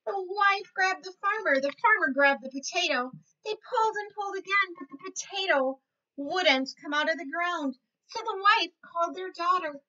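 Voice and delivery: lively storybook voice for little ones; reading to children. A narrator's reading. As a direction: read it like a teacher at story time. Reads picture book to kids.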